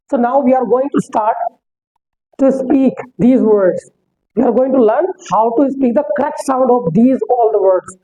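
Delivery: every word spaced out slowly; slow teacher voice. Shown as a demonstration. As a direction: pace it slowly for students. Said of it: "teaching pronunciation".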